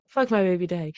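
normal talking voice

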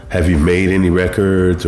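ordinary talk, nothing marked

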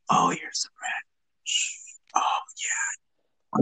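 slow, soft, drawn-out whisper; ASMR whisper. Acted. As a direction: whisper it slowly like an ASMR eating video. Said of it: "it's just like ... Like that, right?".

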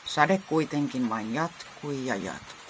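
ordinary delivery, nothing marked

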